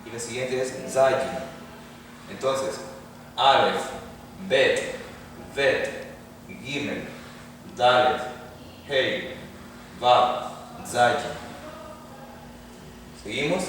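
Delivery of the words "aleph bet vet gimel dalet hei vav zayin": slow alphabet recital. Shown as a demonstration. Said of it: "reciting alphabet for students".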